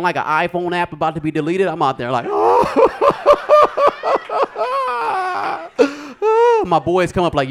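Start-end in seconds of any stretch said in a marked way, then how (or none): 2.3-6.6 loud, high trembling "oh"; panicked wobbly wail, hammed up; nervous shaking cry. Comic acts out waiting outside all nervous, trembling cry. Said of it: "I'm outside all nervous, shaking".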